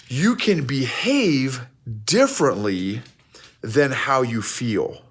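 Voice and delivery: "behave" and "differently" each slow and stressed, with pauses; emphatic, for effect. Real, not acted. Coach giving listeners point number two, slow and emphatic. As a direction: spell out the key point slowly, word group by word group. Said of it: "Pause for effect".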